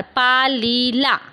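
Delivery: "पालीला" very slowly as पा, ली, ला; teacher's drawn-out breakdown of "पालीला"; syllable by syllable. Shown as a demonstration. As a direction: stretch each syllable of "पालीला" to show the ending. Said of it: "ह्याला जेव्हा आपण प्रत्यय लावणार".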